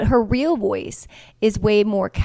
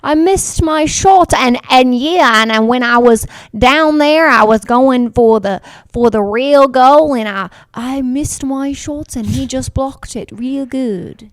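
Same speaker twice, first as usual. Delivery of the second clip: mock interview voice; 4 semitones higher, 9 dB louder, slower than usual. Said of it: "You're like".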